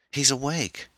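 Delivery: high-pitched, relieved cry; excited cry. A narrator's reading. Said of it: "the voice cried".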